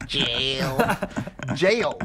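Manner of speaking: imitating "Jail" in a Utah accent; mock Utah accent. Mocking friend's show title, mock Utah accent. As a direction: toss the word back, Utah-style. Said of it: "That's a Utah accent".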